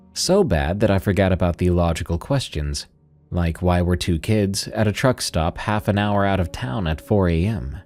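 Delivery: usual speaking voice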